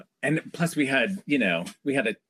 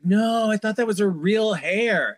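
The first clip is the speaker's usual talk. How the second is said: high-pitched imitation of naive fans; mock naive protest; 8 semitones higher than usual. Stylist mimics people fooled by fake hair, mock dismayed. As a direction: protest in put-on disbelief, voice up high.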